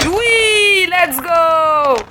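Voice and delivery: loud, high cheer; victory shout. Real, not acted. Streamer celebrating a grab in the game, shouting. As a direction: yell in triumph.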